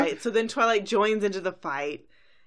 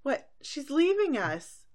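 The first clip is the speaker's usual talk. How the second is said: high-pitched mock distress; distressed friends; 8 semitones higher than usual. Friends learning Twilight is leaving, high mock dismay. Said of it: "the other girls are like".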